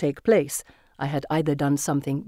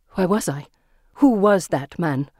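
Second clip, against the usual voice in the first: ordinary delivery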